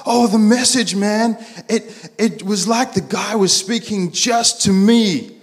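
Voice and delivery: high-pitched, blown-away enthusiasm; excited friend. Retold or imitated. Friend raves to Pete after church, acted excitement. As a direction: gush like an awestruck, hammed-up convert.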